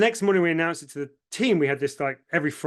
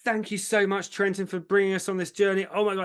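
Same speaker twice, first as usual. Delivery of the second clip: higher, bubbly, gushing thanks; excited team; 6 semitones higher than usual. Retold or imitated. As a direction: imitate an overjoyed team bursting with thanks. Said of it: "jumping up and down, like, with excitement".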